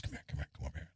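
quiet, secretive come here; hushed beckoning. Retold or imitated. Border official quietly waving him aside, hushed. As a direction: beckon him over under your breath.

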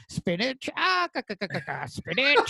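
high cartoon sailor voice with cackle; Popeye impression. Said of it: "doing impressions of Popeye".

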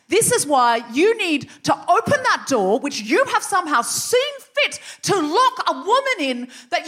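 rising, furious speech building to a high, emphatic peak; impassioned acted outburst, demanding to be released; indignant tirade. Retold outburst at elders locking her in.

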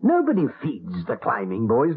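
putting your foot down; indignant forbidding. Acted. Husband forbids milk for boy.

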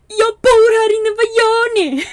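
high, loud retold shout at the strangers; startled yell. Telling the men at her car she lives there, startled yell.

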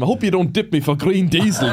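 high, hammed-up Cavan accent; mock-worried Cavan farmer accent; ramped-up Cavan accent. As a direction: plead innocently in broad Cavan. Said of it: "I used to ramp up the accent".

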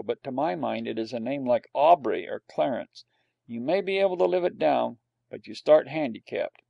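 usual speaking voice

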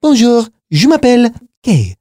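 lightly acted schoolroom French recital; French oral exam recital. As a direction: recite memorised French for an examiner. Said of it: "oral exam back in G C S E was like".

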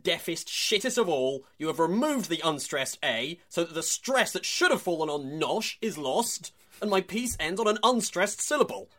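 escalating hammed-up fury ending in swearing; mock furious rant. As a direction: perform a columnist losing it over a syllable. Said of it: "That's all a, a direct quote".

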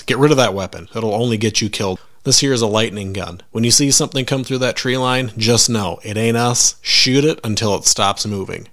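plain reading voice

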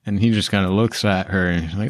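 ordinary delivery

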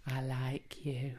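slow unvoiced whisper. Shown as a demonstration. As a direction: whisper it slowly, barely voiced. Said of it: "the inflection he used".

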